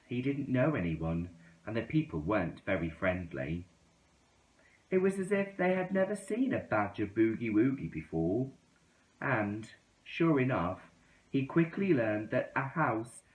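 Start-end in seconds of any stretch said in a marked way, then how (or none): none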